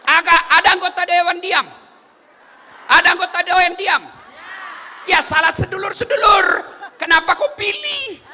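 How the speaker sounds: high, loud challenge to the crowd; fiery preaching. Real, not acted. Preacher scolding voters for silent council members, fiery preaching.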